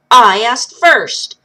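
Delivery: loud and very slow, each word planted; stubborn retort. Acted. Lil answers the wild woman's question with a loud stubborn retort. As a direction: refuse to back down, drag it out.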